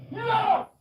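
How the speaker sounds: high victorious shout; excited yell. Real, not acted. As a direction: celebrate the point loudly. Winning a point in game.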